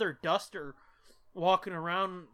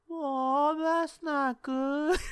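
high, slow, dopey mock concern; hammed-up dazed drunk, higher and slow; mock drunk boyfriend; 7 semitones higher, 3 dB louder, much slower than usual. Drunk boyfriend voiced in story.

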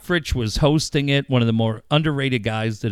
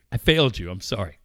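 normal talking voice

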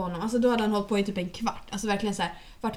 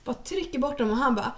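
ordinary delivery

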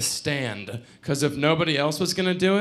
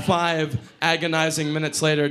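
plain reading voice

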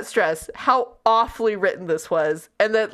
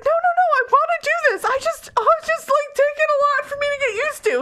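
high, rushed mock reassurance; flustered imitation, pitched way up; a little freaked out; faster than usual. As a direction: blurt it high, tumbling into the first words. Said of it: "a little freaked out".

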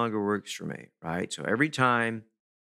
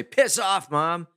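a sudden higher, louder brush-off; rude retort; 7 semitones higher, 4 dB louder, slower than usual. Pretend. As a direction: snap it high and loud at mom. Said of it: "it's probably not a appropriate response".

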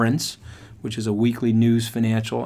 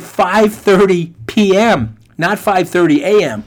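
"Five thirty P M" drawn out loud and high, against "A M"; emphatic correction; 7 semitones higher, 8 dB louder, slower than usual. Retold or imitated. Recruiter correcting student, loud contrastive stress on "P M" and "A M". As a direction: punch "P M" and "A M" as a contrast, voice raised.

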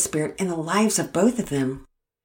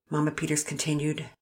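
plain reading voice

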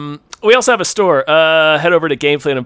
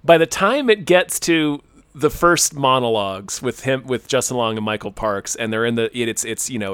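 usual speaking voice

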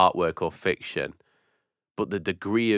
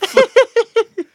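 shrieking burst of laughter; high squealing laugh; 9 dB louder than usual. Real, not acted. Big laugh after werewolf joke.

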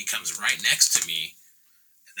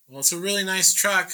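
raised pitch, louder placating voice; pleading reply; 11 semitones higher, 5 dB louder than usual. Retold or imitated. Painter answers an angry truck owner, high and placating.

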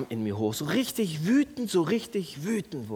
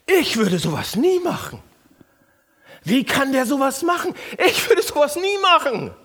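louder, higher, superior indignation; smug self-righteousness; 8 semitones higher, 5 dB louder than usual. Shown as a demonstration. Pastor mocking the self-righteous inner voice. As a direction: puff yourself up over the other. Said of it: "Kennt ihr das?".

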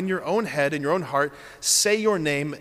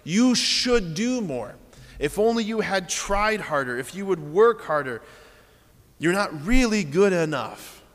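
dripping accusation; 4 semitones higher, 4 dB louder than usual. Acted. Preacher acting the enemy accusing the believer, dripping. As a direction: lay the guilt on, word by word. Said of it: "The enemy's voice is usually dripping and conditional".

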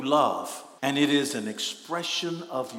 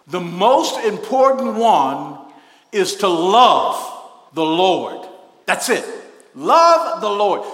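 preaching emphasis; 5 semitones higher, 11 dB louder, slower than usual. Real, not acted. Preacher drives home point.

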